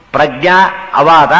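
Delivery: Prajna, then ಅವಾದ, slowly as separate words; Prajna held, a small gap, then ಅವಾದ; word split in two. Shown as a demonstration. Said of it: "ಆಚಾರ್ಯ ಬಿಡಿಸ್ತಾರೆ ಆ ರೀತಿಯಲ್ಲಿ".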